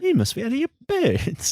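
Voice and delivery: high, lightly voiced English slang line; imitating a British accent. Echoing Davy's odd slang in a mock British accent. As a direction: quote it like a young Englishman. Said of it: "Did the writers make him say it".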